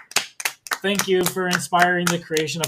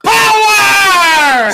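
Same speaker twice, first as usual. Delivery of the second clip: huge high drawn-out shout; mock battle cry; 13 semitones higher, 12 dB louder than usual. Edward summons strength in the recap, hammed-up shout. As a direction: bellow it over the top. Acted roar in movie recap.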